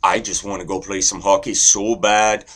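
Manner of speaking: friendly, lively Canadian chatter; Canadian accent. Shown as a demonstration. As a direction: ramble amiably in a Canadian accent. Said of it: "then there's Canadian".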